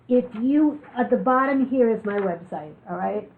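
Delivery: ordinary talk, nothing marked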